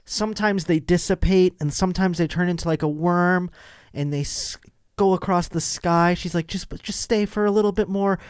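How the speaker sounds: high, eager believer voice; high earnest neighbor. Retold or imitated. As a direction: plead with wide-eyed conviction. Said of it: "she starts going".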